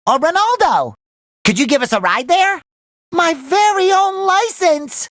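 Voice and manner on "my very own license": high, slow, dreamy wonder; awed delight. Acted. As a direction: savor every word in high-pitched awe. Dream of a license.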